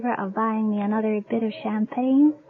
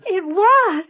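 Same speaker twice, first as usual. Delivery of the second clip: a bright, higher, louder question; surprised; 5 semitones higher, 4 dB louder than usual. Acted. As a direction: caught off guard by good news. Hears test was positive.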